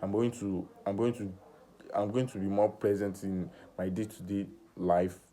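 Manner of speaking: usual speaking voice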